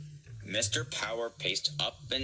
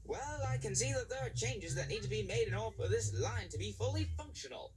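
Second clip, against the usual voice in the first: slightly high, bossy controller; a hint of a brisk new boss; lightly accented controller; 12 semitones higher than usual. A narrator's reading. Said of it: "called Mister Power".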